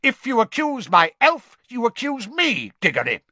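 high, loud threat; outraged, forceful warning; angry shout. A narrator's reading. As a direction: shout it in indignation, dropping on the name. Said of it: "shouted Mister Crouch".

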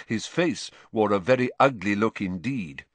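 ordinary delivery

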